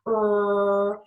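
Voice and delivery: drawn-out English R with rounded lips. Shown as a demonstration. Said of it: "and I go like that".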